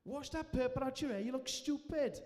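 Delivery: loud, high Welsh gran impression; thick Welsh accent. Welsh grandmother shouting at groom mid-wedding, thick Welsh accent. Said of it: "thick Welsh accent".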